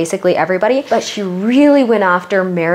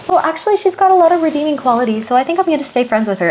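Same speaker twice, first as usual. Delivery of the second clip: higher, sweetly defensive voice; a light mock of a stubborn friend; higher pitched mock loyalty; imitating Heather; 7 semitones higher, faster than usual. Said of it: "Heather's like".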